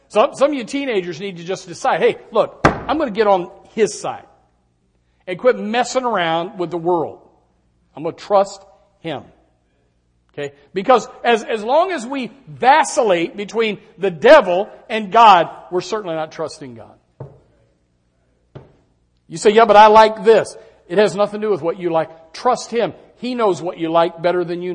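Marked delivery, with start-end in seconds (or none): 0.1-17.0 raised, driving pulpit voice; preaching at full force. Real, not acted. Preacher urging teenagers to choose God, loud and driving. 19.5-20.6 loud, whiny mock objection; mock excuse. Preacher mimicking a teen's excuse, loud and mocking.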